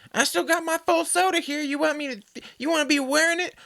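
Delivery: high squeaky mock threat; mock threatening lady. Mocking the relative threatening soda, high squeaky. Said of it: "she goes".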